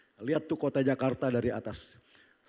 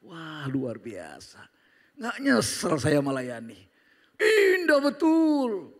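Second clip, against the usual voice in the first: savouring delight; 3 semitones higher, 7 dB louder, much slower than usual. Acted. Savouring the joy of ministry.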